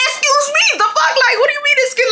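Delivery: very high incredulous comeback; re-enacted squealing outrage; outraged. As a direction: throw it back in disbelief, way up high. Acted quarrel, own outraged comeback.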